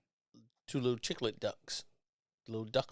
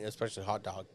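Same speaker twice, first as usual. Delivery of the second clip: usual speaking voice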